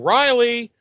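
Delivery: high mock calling into the dark; high sing-song call for Riley. Buffy calling into the caves.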